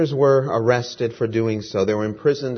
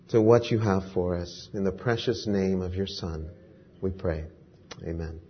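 opening prayer. Acted. Preacher praying over the congregation before the study, reverent and hushed. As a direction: pray it humbly, letting the amen drop away.

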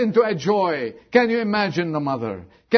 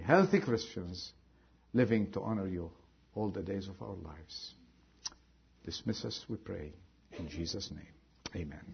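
soft, lower prayer voice; closing prayer; 9 semitones lower, 9 dB quieter than usual. Acted. Preacher leading the congregation in a soft closing prayer. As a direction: pray quietly over the congregation.

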